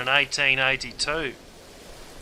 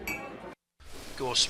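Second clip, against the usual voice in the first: whisper; 7 dB quieter than usual. Acted. Comic aside.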